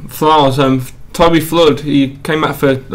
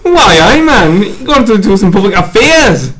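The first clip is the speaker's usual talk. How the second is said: hammed-up, high-pitched Geordie impression; comedy Geordie caricature; bad Geordie accent; 5 semitones higher, slower than usual. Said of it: "that was supposed to be a Geordie accent".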